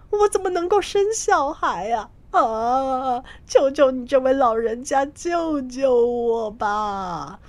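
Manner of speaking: high, sobbing plea; crying. A narrator's reading. Terrified monk sobbing and begging the old woman for help. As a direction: wail and beg through tears. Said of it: "哭着说道".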